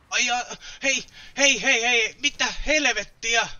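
loud, high string of protests; alarmed protest. Real, not acted. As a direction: protest in real disbelief at the game. Caught out by the game.

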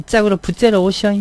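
high-pitched Jeolla-dialect question. Retold or imitated. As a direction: tease in a thick Jeolla lilt. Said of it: "막 이런 거". (높은 톤의 전라도 사투리 질문)

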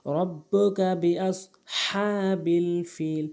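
slow chant, "বিআসহাবিল" with mid-throat ha; big ha. Shown as a demonstration. Teacher modelling the verse, "বিআসহাবিল" with big throat ha. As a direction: recite "বিআসহাবিল" with a strong pharyngeal ha. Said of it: "হা উচ্চারণ করব হলকের মাঝখান থেকে".

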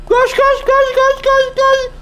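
very high, loud frantic repeated cries; panicked shouting. Real, not acted. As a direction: scream the word in panic as you run. Urging himself to flee.

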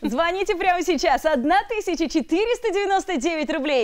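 infomercial. Acted. Closing call-to-buy on shopping TV.

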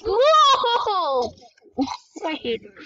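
loud, high cry of frustration; real frustrated shout. Real, not acted. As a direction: yell at the game.

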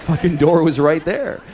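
usual speaking voice

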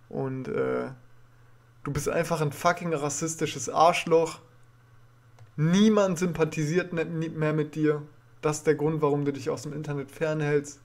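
usual speaking voice

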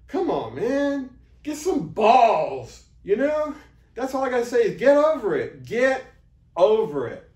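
loud pep-talk rebuke; harsh tough love. Real, not acted. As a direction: tell them to toughen up. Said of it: "I know I'm being harsh".

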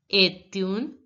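est une run together as "et-yoon"; est une as one joined word; linked words. Shown as a demonstration. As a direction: link est une with a sounded t. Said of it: "we are going to link the words".

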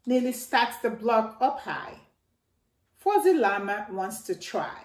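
ordinary delivery

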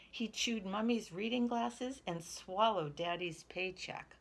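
usual speaking voice

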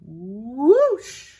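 loud rising ghostly whoosh. Shown as a demonstration. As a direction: swoop the sound like a ghost. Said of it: "I would like you to try this".

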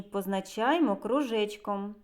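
usual speaking voice

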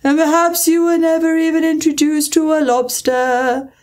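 high, plaintive lament; tearful. A narrator's reading. Mock Turtle laments to Alice, tearful. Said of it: "with tears running down his cheeks".

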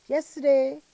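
bright, loud calling start; a loud excited opener; eager exclamation. Acted. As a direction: burst out with the news. Quoted speech in example.

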